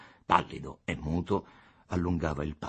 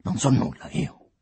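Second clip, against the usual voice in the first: louder, higher exasperated dismissal; huffing exasperation; 9 semitones higher, 7 dB louder than usual. A narrator's reading. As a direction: puff it out impatiently. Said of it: "sbuffò il Costa". (sbuffo esasperato)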